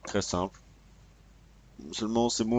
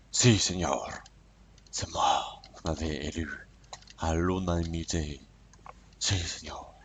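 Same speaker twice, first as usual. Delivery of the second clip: low-pitched mock Mexican president; mauvais accent mexicain; 4 semitones lower, 3 dB quieter than usual. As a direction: play a Mexican leader in a bad, put-on accent. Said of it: "mon accent qui est à chier". (accent mexicain raté, voix basse de président)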